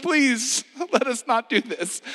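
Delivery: high, loud, laughing mock panic; comic high-pitched Peter protesting; flustered Peter. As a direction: squeak it out like a nervous disciple. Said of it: "Peter's like".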